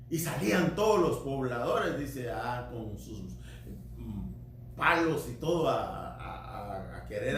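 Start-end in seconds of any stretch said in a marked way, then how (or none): none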